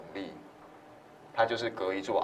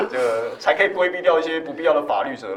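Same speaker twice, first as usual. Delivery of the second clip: laughing; 6 semitones higher, 6 dB louder than usual. Real, not acted. Joking, laughing.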